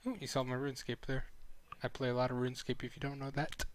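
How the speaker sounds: usual speaking voice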